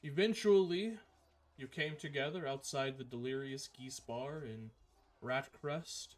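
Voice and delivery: slow, measured scene-setting narration; unhurried storyteller recap; game master narration. Acted.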